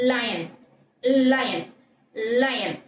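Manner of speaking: "லயன்" with a long L before the vowel; held opening L. Shown as a demonstration. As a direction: curl the tongue and hold the L of "லயன்". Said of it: "நாக்கை நல்லா மடிச்சு வச்சு". ("லயன்" - நீண்ட ல் ஒலியுடன்)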